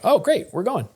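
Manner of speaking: high, pleased retold reaction; light up at being waved through; pleased relief. Driver waved in by the cop, pleased relief.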